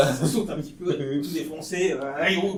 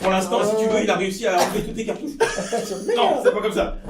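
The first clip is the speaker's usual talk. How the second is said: laughing; 5 semitones higher, 6 dB louder than usual. Real, not acted. Rit en décrivant les cartouches.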